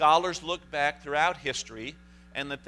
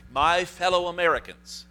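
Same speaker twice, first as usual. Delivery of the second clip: raised, loud presidential address; politician; 6 semitones higher, 8 dB louder than usual. Retold or imitated. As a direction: announce it like a president opening a speech. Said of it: "in it I said".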